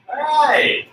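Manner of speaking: high excited cheer; excited shout. Real, not acted. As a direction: hype up the class. Pumping up the class.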